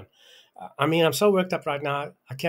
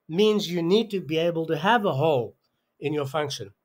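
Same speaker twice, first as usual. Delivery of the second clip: usual speaking voice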